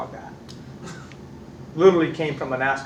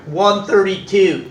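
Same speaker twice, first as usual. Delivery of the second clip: proud; 5 semitones higher, 8 dB louder, much slower than usual. Retold or imitated. Brother boasting top speed.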